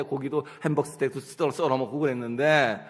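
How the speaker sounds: ordinary talk, nothing marked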